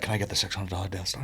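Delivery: soft and fast, wheedling; quick sheepish ask. Retold or imitated. Son asking for expensive Lego, soft quick wheedle. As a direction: slip the request in. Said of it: "he'll be like".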